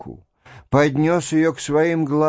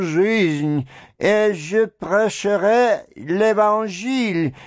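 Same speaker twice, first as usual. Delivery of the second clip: higher, slow, lost babble; dazed babbling; 6 semitones higher, slower than usual. A narrator's reading. Old man to his furious patroness, dazed babble. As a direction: babble it out not knowing himself. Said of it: "залепетал, не помня себя".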